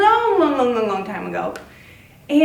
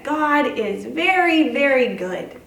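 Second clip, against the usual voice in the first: "God is very, very good" drawn out slow and louder, leaning on "very"; slow, louder lead line for children to repeat; slow, emphatic call-out; 3 semitones higher, 4 dB louder, slower than usual. Shown as a demonstration. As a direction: stretch "very" for the kids to echo. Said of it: "Say it again".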